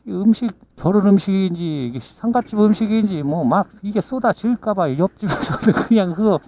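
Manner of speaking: ordinary talk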